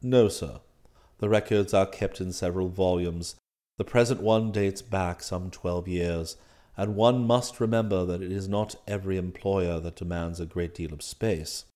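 low, soft, formal manservant voice; unruffled valet. Acted. Valet explains club rules to master, unruffled. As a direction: explain deferentially, deep and composed.

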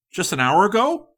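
high, loud and hurried; no time to fool around; impatient go-getter. A narrator's reading. Likens insisting on tonight, high and hasty.

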